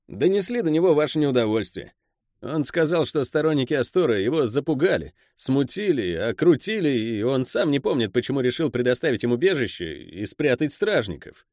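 high-pitched underling reporting to his boss; Pel, the deferential henchman. Acted.

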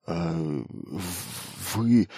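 faint, broken stammer of shock; nearly choking. A narrator's reading. Engineer overwhelmed by his new command, choked stammer. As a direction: catch on the word, barely getting it out. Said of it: "инженер едва не задохнулся".

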